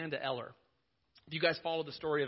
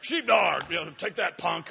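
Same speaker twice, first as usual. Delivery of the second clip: louder, slow tough-cop taunt; the preacher voices a cop taunting the tased culprit, louder and slow; mock tough cop; 9 dB louder than usual. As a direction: ham up a cop gloating over a tased punk. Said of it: "Jonathan found him and tased him".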